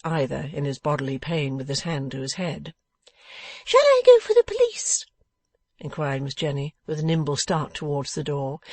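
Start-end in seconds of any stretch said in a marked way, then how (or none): 3.6-5.0 very high, loud, eager piping; sharp little dressmaker. A narrator's reading. Dressmaker offering to fetch police, high and eager. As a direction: pipe it up suddenly, ready to dash off. Dressmaker starts briskly for door.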